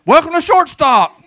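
high, loud, slow shouted greeting; screamed welcome. Pretend. Customer yelling greeting into a shop, loud and high. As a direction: scream the welcome across the room. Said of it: "you screamed that out".

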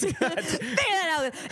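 high laughing repeat. Real, not acted. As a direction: say it through laughter.

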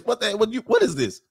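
ordinary talk

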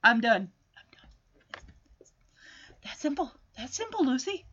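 soft, understated told-you-so; quietly smug. Acted. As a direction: drop it softly, nearly to nothing, job done. Voicing Linus smugly finishing.

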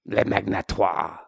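low, soft "Le Magnatoire"; "Le Magnatoire" pronounced grandly as French; French pronunciation. Acted. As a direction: end "Le Magnatoire" on a mock-French "twah". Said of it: "That is what we call him".

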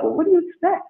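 high-pitched mock exasperation on what do you expect; mock exasperated shrug. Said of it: "I'm like".

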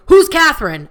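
high, loud mocking question; imitating a rude border guard, high and loud; dumb guard. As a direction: play the guard as loud and dense.

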